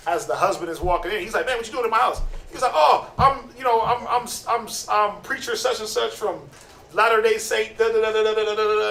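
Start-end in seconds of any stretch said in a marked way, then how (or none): 1.5-2.2 lightly acted husband, higher pitch; mock a suspicious husband's challenge; confronting husband. Husband confronts man in sketch. 2.7-8.9 higher, stammering mock preacher; nervous fake preacher. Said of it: "he's getting all nervous".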